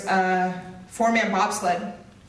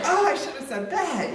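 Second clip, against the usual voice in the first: laughing hindsight; 7 semitones higher than usual. Retold or imitated. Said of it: "you're like".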